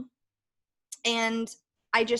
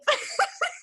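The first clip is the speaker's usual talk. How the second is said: high laugh. Real, not acted. Laughs after the story.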